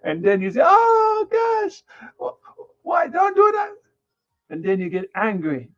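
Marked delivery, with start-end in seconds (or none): 0.6-3.7 put-on high, alarmed gasp at the child; high shocked mock parent. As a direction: cry out like a horrified parent.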